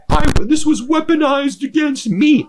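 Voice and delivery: high, whiny mock victim; a falsetto mock of a self-pitying host; mocking whine. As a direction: squeak it out like a wounded victim. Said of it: "she's like".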